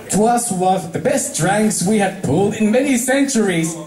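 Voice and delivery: high archaic regal voice; Luna, grandly amused; Princess Luna. Acted. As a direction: savour it like an ancient royal. Luna speaks to Pinkie.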